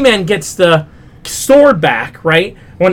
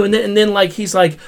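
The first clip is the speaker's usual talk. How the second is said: ordinary delivery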